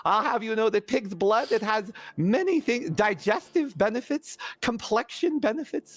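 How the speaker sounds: high, swooping salesman-like character voice; zealous Nibbles, eager crank. Acted. Nibbles selling pig's blood to Lady Taryn, fussily enthusiastic. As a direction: pitch it eagerly, dropping away at the drawbacks.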